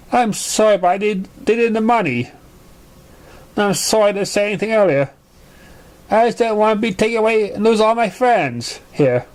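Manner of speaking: a small filly owning up, sorry; lightly voiced nervous filly; young pony confessing. Character voice, confessing.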